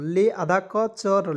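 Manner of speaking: half-speed sounding out of lecture; teacher spelling out lecture; slow syllable breakdown. Shown as a demonstration. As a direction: break lecture into parts slowly. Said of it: "syllable breakdown".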